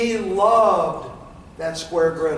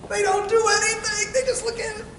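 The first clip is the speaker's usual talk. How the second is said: high mock voice; 13 semitones higher than usual. Mocking stuck customers.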